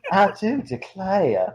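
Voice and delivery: slow, high, drawn-out mock Southern Belle; hammed-up Southern Belle drawl; bad Southern Belle. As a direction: stretch it into a terrible Southern Belle. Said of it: "doing bad Southern Belle over and over".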